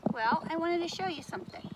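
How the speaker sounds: ordinary talk, nothing marked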